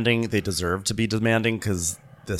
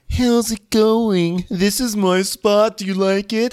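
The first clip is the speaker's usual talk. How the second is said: hammed-up needy chef showing off his spot; mock Matt voice; 11 semitones higher, 5 dB louder than usual. Podcaster mimicking chef showing guests his station, high and whiny.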